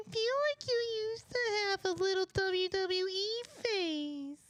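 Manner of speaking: high, slow mocking voice. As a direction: mimic a friend in a squeaky pitch. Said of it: "when Jacob said".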